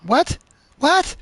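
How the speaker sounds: loud, high disbelieving what; incredulous shout. Real, not acted. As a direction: blurt it out in shock. Game character says it's exciting.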